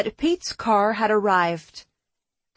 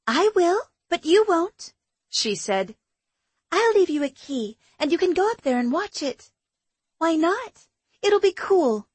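higher, bright friend's voice; young woman voice; 7 semitones higher than usual. Acted. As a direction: voice her as an eager young woman. Shannon's dialogue, higher voice.

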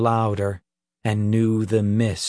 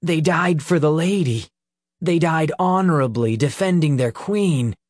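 heated insistence; 6 semitones higher than usual. A narrator's reading. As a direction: insist on it hotly. Peter defends the lady.

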